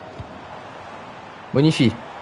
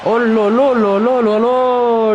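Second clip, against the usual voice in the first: much higher, slow, stretched exclamation; drawn-out oh là là; 8 semitones higher, 3 dB louder, much slower than usual. Real, not acted. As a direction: let the oh là là hang in amazement. Big moment in match commentary. (oh là là étiré, bien plus aigu et lent)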